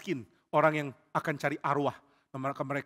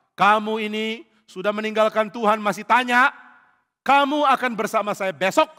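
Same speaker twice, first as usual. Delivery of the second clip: stern spirit; 5 semitones higher, 8 dB louder than usual. Acted. Samuel's spirit rebukes Saul.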